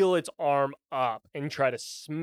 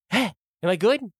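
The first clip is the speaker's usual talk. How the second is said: high, quick, perky creature voice; eager little dragon; 6 semitones higher, 4 dB louder, faster than usual. Acted. Dragon looking up at its master, eager. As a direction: ask brightly and fast, fishing for praise. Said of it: "the dragon jumps down directly to you, looks up like".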